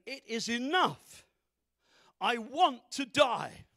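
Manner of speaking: loud, slow, acted despair; anguished declaration. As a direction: cry it out slowly and loudly. Acts Elijah's despairing declaration.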